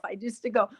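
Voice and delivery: usual speaking voice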